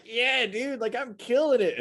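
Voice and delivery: high-pitched, hyped-up bragging; mock-excited boast in a high voice; hyped self-confidence. Voicing someone proud of own work.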